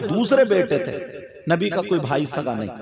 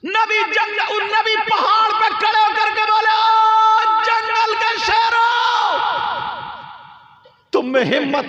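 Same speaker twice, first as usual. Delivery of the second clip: full-force preaching; 13 semitones higher, 4 dB louder than usual. Acted. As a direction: cry the story out to the crowd at full force. (ऊँची, ज़ोरदार तक़रीर की आवाज़)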